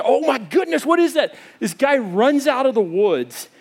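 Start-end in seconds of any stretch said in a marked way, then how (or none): 0.0-1.4 rising, a little higher, alarmed; startled stammer. Retold or imitated. Preacher retelling his junior high fright, mildly panicked. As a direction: blurt it like a nervous kid hearing a chainsaw. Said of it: "I start to stutter and speak".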